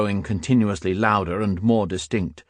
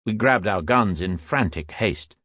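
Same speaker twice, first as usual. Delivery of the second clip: plain reading voice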